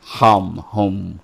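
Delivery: ordinary talk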